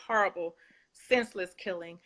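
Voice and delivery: ordinary delivery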